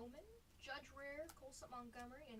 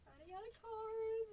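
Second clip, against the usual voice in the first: mock cry; 8 semitones higher, 5 dB louder than usual. Mock despair over dwindling cards.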